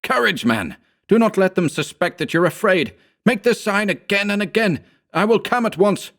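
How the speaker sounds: high, urgent near-scream; screaming into the phone. A narrator's reading. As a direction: shout courage down the line. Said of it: "I fairly screamed into the receiver".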